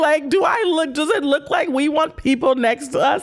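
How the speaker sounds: high-pitched, hammed-up indignation; mock upset. Tennis player complaining about a man at next table, mock upset. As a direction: rant in comic outrage, stretching the last word. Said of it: "so upset".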